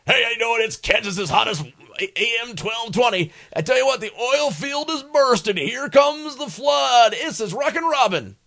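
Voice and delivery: loud, high, hyped oldies DJ patter; sixties DJ. Retold or imitated. Old DJ hamming up his radio break, loud and high. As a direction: boom it out like a sixties AM jock. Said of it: "this is pretty much the exact verbiage".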